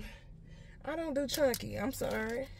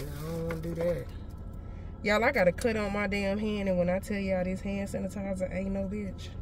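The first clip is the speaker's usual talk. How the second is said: usual speaking voice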